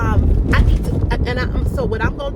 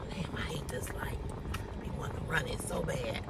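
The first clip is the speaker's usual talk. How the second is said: hushed whisper to herself; whispered aside; 7 semitones lower, 16 dB quieter than usual. Real, not acted. Driver whispering to herself at a red light, hushed. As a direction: whisper it under your breath, itching to run the light.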